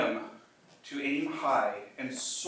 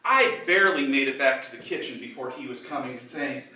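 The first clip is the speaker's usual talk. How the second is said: ordinary talk, nothing marked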